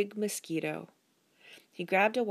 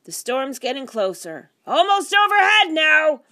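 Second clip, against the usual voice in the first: raised voice building to a high yell; shouted; 8 semitones higher, 13 dB louder than usual. A narrator's reading. Husband tells wife the storm is near, shouting. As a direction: start loud, climb to a full shout. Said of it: "Mister Burbank shouted".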